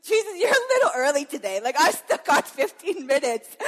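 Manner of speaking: loud, high, laughing reproach; laughing teasing. Retold or imitated. Laughing at Jesus for waking her early, teasing loudly. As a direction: tease him while laughing. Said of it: "I started to laugh".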